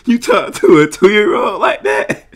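high, drawn-out disbelief through laughter; real amused, high-pitched outburst; incredulous laughing. Real, not acted. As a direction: crack up while asking in disbelief. Cracking up at the comedy clip.

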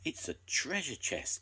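a touch of wonder, voice lifted; gasped. A narrator's reading. Boy discovering a treasure chest, mildly gasping. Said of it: "gasped Bill".

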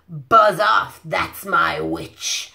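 low, slow growl from a horrible beast. Acted. Reading to a child, the beast growls at the dragon. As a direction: drag it out low and menacing. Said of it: "its terrible voice ... a yowl and a growl".

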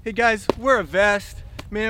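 ordinary delivery

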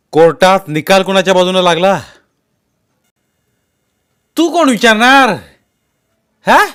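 loud, high shouting match; two men squaring off in anger; angry quarrel. A narrator's reading. Heated quarrel between two men. (तावातावाने भांडण)

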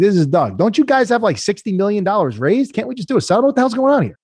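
mock-shrill angry executive on a call; Doug's indignant voice. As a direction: play the CEO as outraged and incredulous. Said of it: "Doug comes off of mute".

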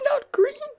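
very high protesting voice; upset little character. A narrator's reading. Posy turns down Pip's teddy, upset and high-voiced.

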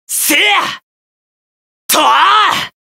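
high, sharp attack yell; short fighting kiai; battle cry. Acted. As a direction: strike and shout it out. Attack cries in combat.

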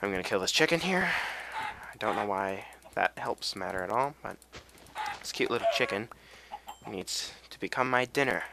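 normal talking voice

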